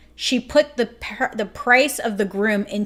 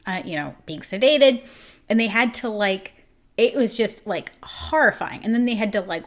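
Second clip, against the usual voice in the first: ordinary talk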